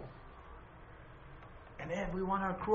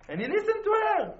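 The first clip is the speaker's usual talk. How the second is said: ordinary delivery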